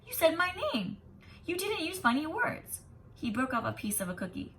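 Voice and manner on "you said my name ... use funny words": put-on excited boy. A narrator's reading. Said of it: "he shouted".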